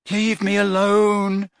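slow, dismissive brush-off; impatient. A narrator's reading. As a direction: wave her off impatiently. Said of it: "said Ron impatiently".

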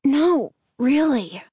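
plain reading voice

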